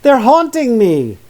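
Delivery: high, loud terrified scream. Acted. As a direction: scream it in panic at the bees. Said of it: "She looked up and screamed".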